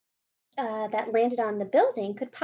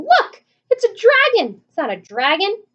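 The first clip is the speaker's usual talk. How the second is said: very high, loud frantic yells; panicked shouting; 10 semitones higher, 14 dB louder than usual. A narrator's reading. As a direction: scream out each monster name. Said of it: "all started shouting".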